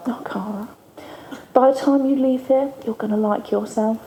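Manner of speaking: imitating a soft, near-whispered comfort; hushed reassurance. Charlie reassuring a girl who hates herself, hushed and gentle. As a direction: gently breathe it like a kind counsellor.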